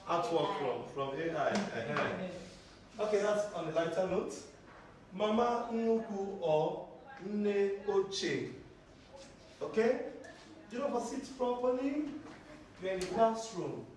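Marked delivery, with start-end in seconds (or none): 5.1-8.5 Mama Nuku or Nne Oche, spoken slowly with each word kept apart; slow model phrase. Shown as a demonstration. Teacher modelling grandma names for the students, slowly. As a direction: spell out both names slowly for the class. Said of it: "Either you say Mama Nuku or you say what?".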